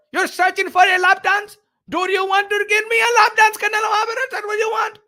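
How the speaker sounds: high, loud indignant rich boss ranting, bursts of shouting; hammed-up outraged sheikh refusing to be used; mock Excellency. Streamer voicing the Excellency yelling at Canelo, high and outraged. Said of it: "The Excellency ain't playing around".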